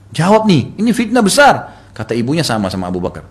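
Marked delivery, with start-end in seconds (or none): 0.1-1.8 loud, high-pitched appeal; urgent demand. Retold or imitated. Aisyah urging her mother to answer, urgent demand. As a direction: press for an answer, voice raised.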